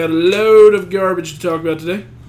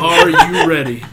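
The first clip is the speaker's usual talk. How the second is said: carnival host; 2 semitones lower, 4 dB louder, slower than usual. Acted. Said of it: "carnival host".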